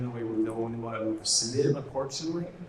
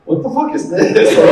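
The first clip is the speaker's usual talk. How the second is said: mock baffled actor; 12 semitones higher, 12 dB louder than usual. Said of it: "he'd be like".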